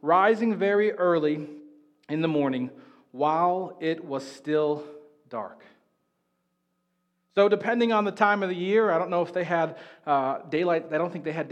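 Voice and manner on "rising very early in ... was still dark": slow, hushed reading of the verse; scripture reading. Acted. Pastor reads the verse to the congregation, slow and hushed. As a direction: read it slow and reverent, letting it settle.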